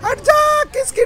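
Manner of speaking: very high-pitched cry to move; sudden high warning call; high yell. Real, not acted. Yelling at an oncoming tank.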